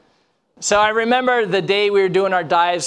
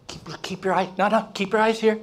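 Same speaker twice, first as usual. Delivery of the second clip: gently urgent, higher than the hush; insistent redirecting; 4 semitones higher than usual. Retold or imitated. Instructor to a student looking around, insistent. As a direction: pull back a wandering gaze, imitating an instructor. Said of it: "they started looking around".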